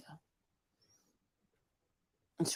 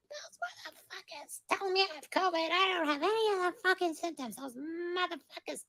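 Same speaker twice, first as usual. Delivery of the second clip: imitating mom; 13 semitones higher than usual. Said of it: "She goes".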